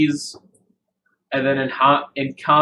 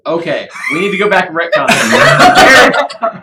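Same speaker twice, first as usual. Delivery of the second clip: laughing; 7 semitones higher, 11 dB louder than usual. Real, not acted. GM laughing at players' plan.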